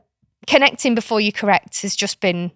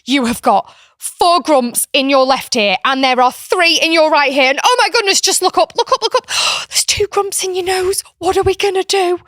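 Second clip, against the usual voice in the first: high, loud, hammed-up excitement about grumps; playful mock alarm; 9 semitones higher, 6 dB louder than usual. Aunt distracting a screaming niece, high excited mock panic. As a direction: whip up a silly panic over imaginary grumps. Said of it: "I'm like".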